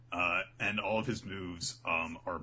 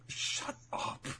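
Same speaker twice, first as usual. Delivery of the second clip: ordinary delivery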